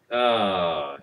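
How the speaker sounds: ordinary delivery, nothing marked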